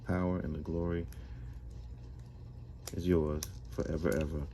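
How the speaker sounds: soft, reverent recited prayer. Real, not acted. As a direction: pray it quietly and earnestly.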